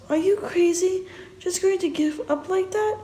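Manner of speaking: appalled, can't believe it; shocked disbelief. Acted. Alex appalled that James gives Sophie up, voice high.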